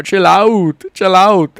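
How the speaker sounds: lightly imitated South African accent; a hint of a South African drawl; Dricus du Plessis impression. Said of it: "as Dricus du Plessis would say".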